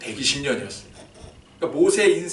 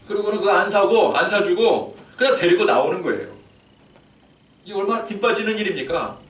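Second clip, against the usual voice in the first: higher and louder, protesting; indignant outburst; 6 semitones higher, 4 dB louder than usual. Real, not acted. Preacher protesting to his flock, higher, louder and indignant. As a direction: rouse the congregation against the unfairness.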